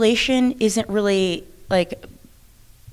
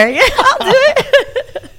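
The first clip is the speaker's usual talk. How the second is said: laughing; 7 dB louder, much slower than usual. Retold or imitated. Jokes about wrestling at eighty-five.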